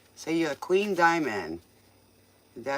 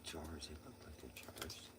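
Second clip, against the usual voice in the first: whispering; 12 semitones lower, 21 dB quieter than usual. Real, not acted. Whispering at night.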